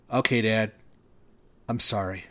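the son finally caves, voice sinking; son relenting. A narrator's reading. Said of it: "the son relents".

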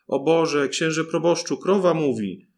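loud alarmed outcry; frightened cry. A narrator's reading. The cook runs to the priest, panicked shout. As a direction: blurt it out in panic. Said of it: "W trwodze pobiegła ... zawołała".

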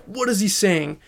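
usual speaking voice

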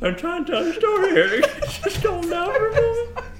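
high, squealing protest through laughter; laughing helplessly. Real, not acted. Storyteller breaking up mid-tale, laughing helplessly. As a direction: barely get the words out for laughing.

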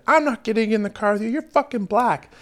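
squeaky mocking imitation of her; mock drunk girl. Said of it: "She spouted some shit like".

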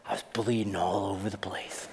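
mock whisper.

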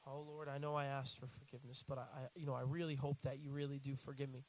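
anxious pleading. Retold or imitated. Believer nervously begging God again after confessing. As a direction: beg timidly for reassurance. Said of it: "five minutes later".

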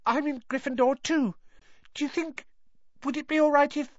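very high, timid schoolboy voice; high-pitched eager little fan; hopeful young boy. A narrator's reading. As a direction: pipe it up high, hoping for a yes. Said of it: "he said, raising the camera hopefully".

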